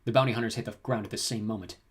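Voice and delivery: usual speaking voice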